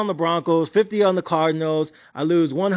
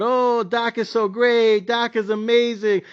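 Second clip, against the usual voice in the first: slow, drawn-out fawning praise; lightly voiced gushing commentator; mock gushing Tom Brady; 5 semitones higher, slower than usual. Tom Brady praising Dak on the broadcast, slow mock gushing. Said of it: "the sports cast, talking about".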